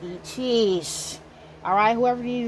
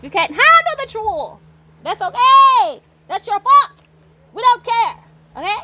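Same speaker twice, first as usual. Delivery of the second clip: taunting shout; 11 semitones higher, 9 dB louder than usual. Real, not acted. Taunting the enemies.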